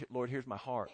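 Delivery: low, very soft prayer; humble prayer. Acted. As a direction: offer it up quietly to God. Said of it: "a humble".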